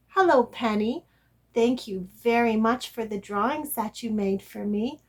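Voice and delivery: usual speaking voice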